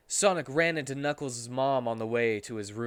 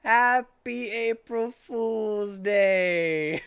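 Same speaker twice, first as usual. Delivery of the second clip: very slow, loud, higher drawn-out call; hammed-up greeting; 9 semitones higher, 7 dB louder, much slower than usual. Host wishing listeners April Fools, slow hammed call. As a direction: stretch it out like a mock announcer.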